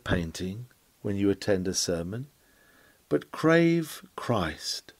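an intimate whispered plea to the congregation; whispered urging. Acted. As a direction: drop to a whisper and draw out each word. Preacher's hushed urging climax.

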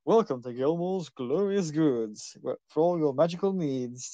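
lightly hammed-up shop jingle, slow; a touch of a grand shopkeeper ad. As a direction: announce the shop grandly, slowly. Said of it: "projection illusion of himself going".

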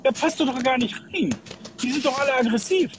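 normal talking voice

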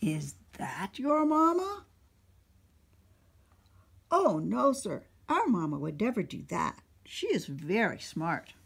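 put-on animated children's story narration; storytime read-aloud. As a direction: read it out to a room of little kids. Reads picture book to children.

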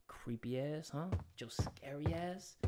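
very soft, voiceless whisper. Real, not acted. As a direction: whisper it under your breath. Muttering at the creepy baby.

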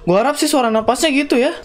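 ordinary talk

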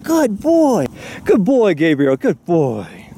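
high sing-song praise; high cooing praise for the cheetah; pet talk. Real, not acted. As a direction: praise him like a good dog.

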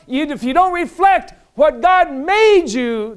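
high, loud, driving sermon; preaching at full force. Real, not acted. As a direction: thunder it out to the congregation.

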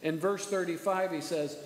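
ordinary talk, nothing marked

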